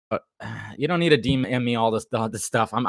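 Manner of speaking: normal talking voice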